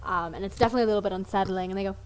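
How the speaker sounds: normal talking voice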